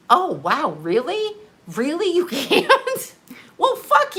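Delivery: high, sarcastic "Really? You can't?" with a laugh breaking in; squeaky, sarcastic "really?" twice, the voice climbing each time; mock disbelief. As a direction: overplay your shock at the clerk.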